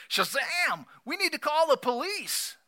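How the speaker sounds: high-pitched excited yelp; excited Gomer. A narrator's reading. Gomer calls out excitedly to Barney during the robbery. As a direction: blurt it out like an alarmed deputy. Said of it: "Gomer looks at Barney and says excitedly".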